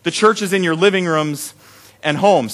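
normal talking voice

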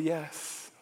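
lightly voiced divine voice, hushed; the voice of God. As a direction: quietly send him out. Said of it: "And the voice said".

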